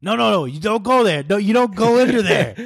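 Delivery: high-pitched imitation of a scolding dad; dad correcting toddler. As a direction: mimic a dad heading off his toddler.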